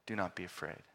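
almost breathe it as comfort; soft reassurance to frightened disciples; tender hush. Acted. Sermon climax quoting Jesus softly.

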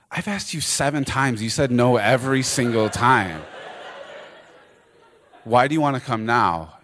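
playfully fed-up disbelief; amused exasperation. Retold or imitated. Becky exasperated at her friend.

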